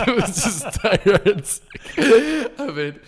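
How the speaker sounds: high laughing voice. Real, not acted. [name] telling the squirrel story to the host, laughing high. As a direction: laugh through the words. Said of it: "laughing at himself".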